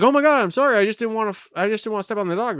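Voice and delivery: high, wavering mock old lady apology, rushing the end. Retelling the old lady who tripped over a dog, high-voiced. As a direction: play a flustered old lady, lightly. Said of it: "she was like".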